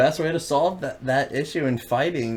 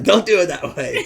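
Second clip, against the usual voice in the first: higher, louder mock correction; mock scolding; 10 semitones higher, 6 dB louder than usual. Telling his roommate how to do chores, mock scolding. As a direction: scold a roommate through a laugh. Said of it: "I'm like".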